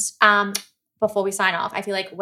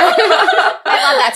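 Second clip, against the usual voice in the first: delighted squeal; 12 semitones higher, 7 dB louder, much slower than usual. Real, not acted. Delighted squeal after a laugh.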